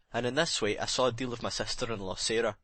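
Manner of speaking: ordinary delivery, nothing marked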